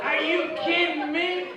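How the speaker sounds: high, slow incredulous cry; mock disbelief. Real, not acted. Comic tells crowd, high slow disbelief. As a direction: act amazed at the tiny fine.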